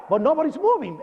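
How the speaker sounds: normal talking voice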